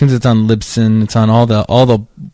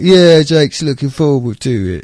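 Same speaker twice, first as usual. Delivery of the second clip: put-on English accent. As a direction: fake English manager voice. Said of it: "gave him an English accent".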